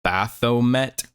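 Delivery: Baphomet as BATH-oh-met, slowly sounded out; Baphomet drawn out, "BATH-oh-MET"; pronunciation attempt. Shown as a demonstration. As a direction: try Baphomet slowly with a th and a long O. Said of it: "is that how you say it".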